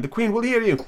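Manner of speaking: high, mildly alarmed warning; frightened whisper. A narrator's reading. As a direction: warn anxiously of being overheard. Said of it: "whispered in a frightened tone".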